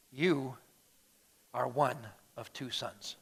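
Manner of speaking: grave verdict dropping almost to a murmur; hushed, solemn. Acted. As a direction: let each phrase land quietly, with gaps. Solemn punchline as Jesus speaking.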